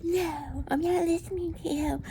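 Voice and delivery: imitating a stubborn know-it-all; mocking stubborn boyfriend. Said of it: "and he's like".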